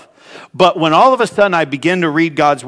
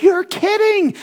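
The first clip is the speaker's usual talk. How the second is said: high incredulous you're kidding; disbelieving. Retold or imitated. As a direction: pitch it way up in disbelief. Retold disbelief.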